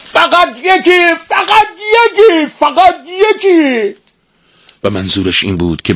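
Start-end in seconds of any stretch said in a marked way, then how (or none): 0.0-3.9 high, drawn-out repeated yell; desperate shouting. A narrator's reading. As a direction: shout pleadingly after someone running away.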